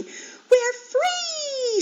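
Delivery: high, bright squeal of joy; joyful piglet squeal; excited squeal. A narrator's reading. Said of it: "she squealed".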